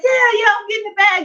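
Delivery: high and gleeful; retold high-pitched giddy brag; giddy reply. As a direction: crow it, delighted with yourself. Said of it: "And I was like".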